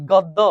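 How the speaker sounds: "গদ্য" as gawddo; open-vowel mispronunciation. Shown as a demonstration. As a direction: hold up gawddo for "গদ্য" as the mistake. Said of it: "গদ্য না, গদ্য".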